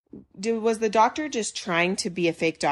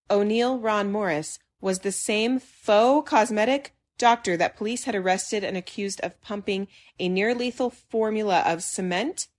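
normal talking voice

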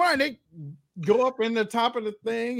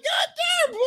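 high shout, way up; exclaiming shout; faster than usual. Retold or imitated. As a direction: yell it in disbelief. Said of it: "I'm like".

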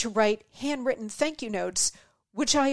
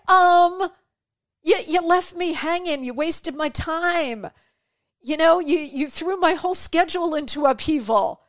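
indignant complaint; 4 semitones higher than usual. Retold or imitated. Confronting someone who ignored you.

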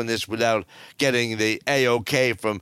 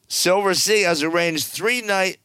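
normal talking voice

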